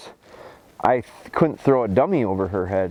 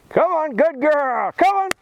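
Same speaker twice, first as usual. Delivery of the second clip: excited high cheering. Real, not acted. Trainer firing up his dog on the retrieve, high excited cheer. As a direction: pour gas on the fire with your voice. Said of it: "you see how my voice and my excitement".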